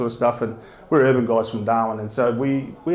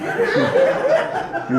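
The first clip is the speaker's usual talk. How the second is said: a squealing, high laugh at his own punchline; high burst of laughter. Real, not acted.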